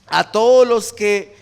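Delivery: slow, loud Bible verse; measured, forceful reading to a congregation; scripture recitation. Real, not acted. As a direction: proclaim the verse phrase by phrase.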